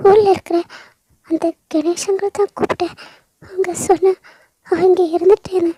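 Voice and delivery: high, loud, tearful crying voice; sobbing grief. Acted. Veda telling Stella her parents died, sobbing loudly. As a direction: wail the news through tears.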